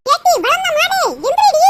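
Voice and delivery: shouted rebuke, hitting the last word hard; angry yelling. Acted. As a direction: yell at a lazy sleeper to get up. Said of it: "காட்டு கத்த கத்திக்கிட்டு இருக்கேன்".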